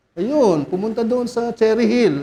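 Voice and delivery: ordinary delivery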